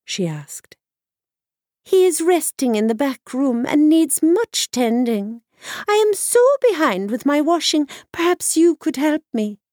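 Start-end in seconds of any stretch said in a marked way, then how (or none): 1.8-9.6 put-on high, sly old washerwoman; wily old woman gossiping up high. Wily washerwoman asks help.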